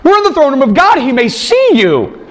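high, stammering, panicky angel scolding; a frantic angel hissing at a blundering John; flustered angel. Acted. Angel scolds John in the throne room, high and flustered. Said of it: "But he said to me".

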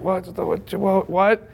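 imitating his own startled stammer; shocked dad. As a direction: sputter like a stunned father. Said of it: "And I'm like".

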